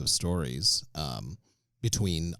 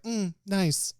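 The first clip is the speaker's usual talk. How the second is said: mock polite disinterest; 13 semitones higher than usual. People reacting politely to podcast.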